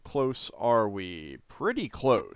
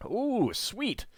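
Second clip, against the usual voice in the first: excited; 14 semitones higher than usual. Real, not acted. Finds loot.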